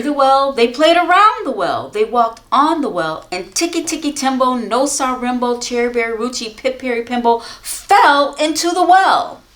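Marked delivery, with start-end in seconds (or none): none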